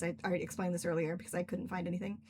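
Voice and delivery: ordinary delivery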